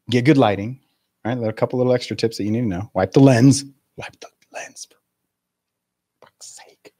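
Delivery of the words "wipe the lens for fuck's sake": hushed, slow, fed-up whisper; exasperated whisper. Real, not acted. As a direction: mutter it under your breath in frustration. Exasperated aside about lens.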